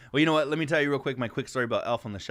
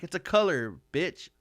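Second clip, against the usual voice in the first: sassy comeback; 6 semitones higher than usual. Retold or imitated. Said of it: "I'm like".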